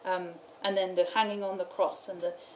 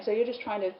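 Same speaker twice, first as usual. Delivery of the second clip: usual speaking voice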